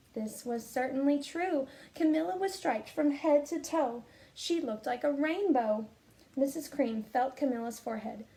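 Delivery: put-on lively, expressive reading to children; storybook read-aloud. Teacher reading a picture book to her students, expressive storytelling. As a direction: read it like story time for the class.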